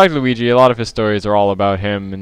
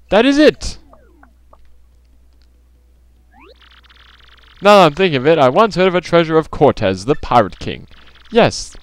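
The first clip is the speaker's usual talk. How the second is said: high, swooping theatrical exclamations; pompous rich trader, thrilled; 7 semitones higher, 3 dB louder than usual. Acted. Rich trader seizing on a treasure hunt, theatrical delight. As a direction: declaim it with mounting grand excitement.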